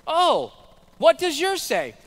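high, loud mock interest; imitated class teacher. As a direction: play an over-eager teacher answering up high. Teacher answers the objecting lady.

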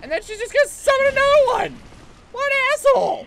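very high, loud screech; fast high-pitched outraged yell; frustrated shouting. Real, not acted. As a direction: scream in real exasperation. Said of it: "That's the frustrating shit".

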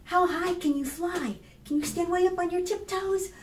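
ordinary delivery

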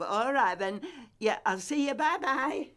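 usual speaking voice

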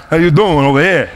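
put-on working class accent. Speaker showing how he talked growing up, New Jersey working class accent. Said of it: "I used to talk with this kind of working class accent".

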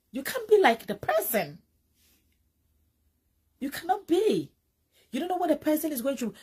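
loud insistent preaching. Real, not acted. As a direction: drive it home, voice up and loud. Insists you can't copy celebrities.

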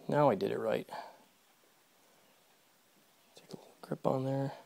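normal talking voice

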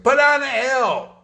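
loud, high proclamation of the verse; booming command. Retold or imitated. Preacher booming Jesus's words to the crowd, loud. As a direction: ring it out to the whole room. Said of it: "what did Jesus say?".

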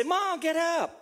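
put-on high-pitched child urging mom up; imitated child rousing a parent; Gabriel. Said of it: "Gabriel will say".

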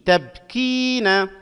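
very slowly stretch "كي" in "تبكين"; drawn-out long vowel. Shown as a demonstration. Teacher analysing the word for students, drawn-out long vowel. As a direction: hold a high long i in "تبكين". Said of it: "مد مع الممدود".

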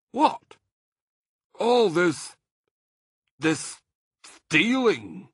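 high, loud and halting; hesitant Badger. A narrator's reading. Badger admitting his doubts to Mr Fox, halting. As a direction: stumble over the word stealing.

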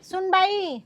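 high-pitched summoning call. Acted. Mother-in-law summoning daughter-in-law, calling out. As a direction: call into the house for someone.

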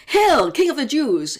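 mocking soldiers. Soldiers mocking Jesus, a loud jeering hail. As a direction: jeer it out in mock salute.

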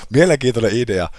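a hint of a mock pompous critic. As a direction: parody his own remark in a silly voice. Repeats remark in put-on voice.

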